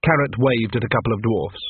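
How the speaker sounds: usual speaking voice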